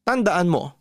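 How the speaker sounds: plain reading voice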